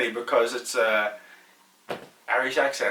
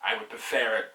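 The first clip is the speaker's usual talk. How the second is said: ordinary delivery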